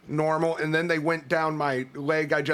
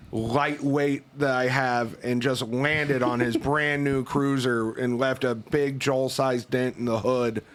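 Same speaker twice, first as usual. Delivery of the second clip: ordinary talk, nothing marked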